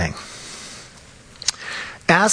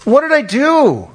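voicing Job's anguish; 10 semitones higher than usual. Preacher voicing Job crying out to God, high and pleading. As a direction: plead like a man who can't make sense of his pain. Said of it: "In other words".

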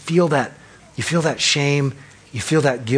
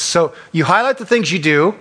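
usual speaking voice

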